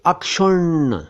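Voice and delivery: अक्षुण्ण drawn out as "ak-shunn"; careful pronunciation. Shown as a demonstration. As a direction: clear k-sh in अक्षुण्ण. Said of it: "ज़रा ध्यान से देखिए".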